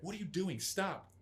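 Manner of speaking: retold panicked yell, high and loud. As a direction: imitate a wife yelling to stop. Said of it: "she's yelling at me now".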